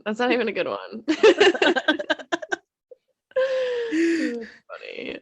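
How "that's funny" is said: laughing hard. Real, not acted. Laughing at the joke.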